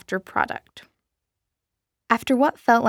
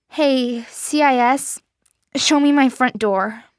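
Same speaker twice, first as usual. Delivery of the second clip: louder and higher, tense command; anxious urgent command; 4 semitones higher, 5 dB louder than usual. Acted. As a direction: snap it out with nerves. Jean urgently commands watch.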